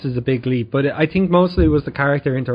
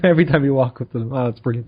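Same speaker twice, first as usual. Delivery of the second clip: ordinary talk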